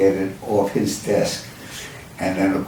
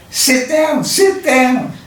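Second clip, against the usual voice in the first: high-pitched, louder retold yell; imitated yell; 4 dB louder, slower than usual. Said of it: "and she yells".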